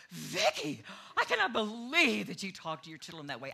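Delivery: loud, scandalized scolding; appalled mother. Retold or imitated. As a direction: imitate a mother aghast at her daughter. Retelling mother's shocked scolding.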